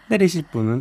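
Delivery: announcer voice. Retold or imitated. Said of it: "안내원 목소리".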